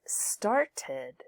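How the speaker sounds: "started" slowly split as star-tid; extra id syllable. Shown as a demonstration. Teacher shows students the extra syllable, "started" said slowly as two parts. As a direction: sound out "started" with a separate final id. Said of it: "you've got an extra syllable there".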